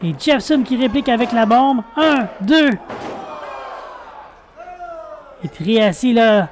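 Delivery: lively play-by-play call; wrestling commentator. Real, not acted. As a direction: call the match live as it unfolds. (commentateur de catch)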